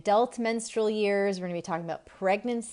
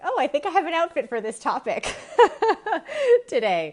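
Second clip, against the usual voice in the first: giggling through a high, loud mock thought; laughing self-quote; 10 semitones higher, 7 dB louder than usual. Host laughing to viewers about her nautical outfit, giggling. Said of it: "I got a kick out of like".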